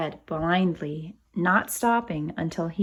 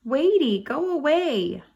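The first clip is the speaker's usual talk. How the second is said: put-on high, loud baby shooing; cross toddler; 6 semitones higher, 4 dB louder than usual. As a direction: push the dog away like a toddler. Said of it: "Baby Louie said".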